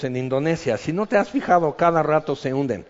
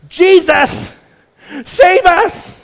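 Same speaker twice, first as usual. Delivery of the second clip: much higher, loud cry for help; desperate scream; 12 semitones higher, 7 dB louder, much slower than usual. Retold or imitated. Traveler on a sinking boat screaming loudly to Jesus. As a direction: scream it as the boat goes under. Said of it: "¿qué está gritando?".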